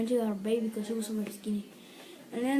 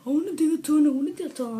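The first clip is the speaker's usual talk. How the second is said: insistent shout; 5 semitones higher, 9 dB louder, much faster than usual. Retold or imitated. Harlan insisting on digging.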